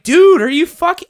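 retold disbelief. Retelling his reaction to friend at work, mock outrage. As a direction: re-enact your outraged reaction, cut short. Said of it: "I'm like".

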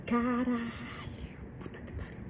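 stunned slow whisper; whispered curse. Real, not acted. Reader shocked by the twist, real whispered curse. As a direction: breathe the swear under your breath.

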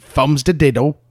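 loud, hammed-up Cockney nonsense; mock Cockney accent. Co-host joins the dare with loud fake Cockney. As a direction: belt out fake Cockney slang. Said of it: "do this in a Cockney accent".

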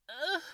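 high, loud mock moan; imitated moan. Shown as a demonstration. Storyteller trying his best moan for listeners, imitated moan. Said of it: "do my best moan here".

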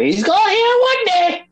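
squeaky high mock voice; high silly voice. Friend mimicking his own weird noise. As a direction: pitch it way up and silly.

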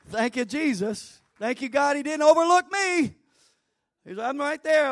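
a hammed-up boast of being chosen; mock proud thanks. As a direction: thank God with hammed-up pride.

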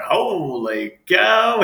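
amazed. Retold or imitated. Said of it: "I was like".

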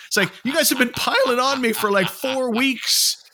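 high, slowed, aggrieved complaint; exasperated. Real, not acted. Complaining to his cohost about being teased, high and exasperated. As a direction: protest like you've been piled on.

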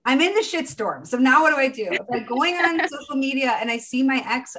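loud, high-pitched acted-out overwhelm; mock exasperation. Coach voices client's overwhelmed inner reaction.

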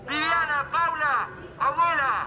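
high megaphone calls; megaphone roll call. Acted. Father summoning his scattered family, megaphone shout. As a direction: bellow each one's name through the loudhailer. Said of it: "deme ese megáfono".

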